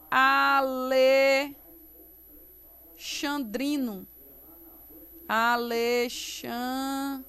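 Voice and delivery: ALEXANDRINO stretched out with held syllables; drawn-out term, pausing before DRINO; slow, syllable by syllable. Shown as a demonstration. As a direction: say it very slowly while writing it. Teacher spells term while writing.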